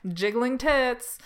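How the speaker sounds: exaggerated, stretched-out mock enthusiasm; mock showmaker voice. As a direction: draw it out like a sleazy pitch. Same acted quote continues.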